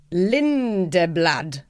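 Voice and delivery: Lindeblad drawn out slowly, syllable by syllable; tentative Scandi pronunciation. Real, not acted. Guest unsure of the monk's Scandi surname, Lindeblad sounded out slowly. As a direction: have a careful, unsure go at Lindeblad, stretching it slowly. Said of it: "however you say that in a Scandi accent".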